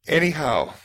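ordinary talk, nothing marked

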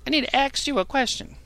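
high, whiny caller voice; high mock caller voice, put on for laughs; mocking a dumb caller. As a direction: mimic a clueless caller begging to ask. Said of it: "certain people that call in".

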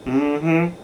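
normal talking voice

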